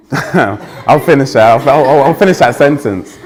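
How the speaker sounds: laughing. Real, not acted. Speaker laughing at the crowd's reaction to his advice, laughing. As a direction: laugh it off and finish the line.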